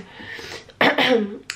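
rough throat clear. Real, not acted. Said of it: "avec cette voix".

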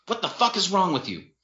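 high-pitched outraged demand; angry shouting. A narrator's reading. Sister shouting at her drunk brother, outraged. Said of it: "Jennifer shouted".

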